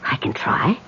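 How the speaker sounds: softly whispered reply; hushed medium's whisper. Acted. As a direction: breathe the words out.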